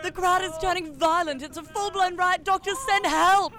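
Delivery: loud, fast, frantic report; panicked reporter. Acted.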